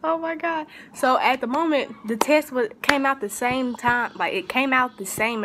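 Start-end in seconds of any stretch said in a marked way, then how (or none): 0.0-0.7 crying. Retold or imitated. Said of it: "I was just in there crying".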